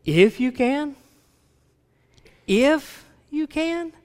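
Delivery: high, rising disbelief, slowing on the repeat; incredulous exclamation. Acted. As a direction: throw it back as a rhetorical question. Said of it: "he's exclaiming that".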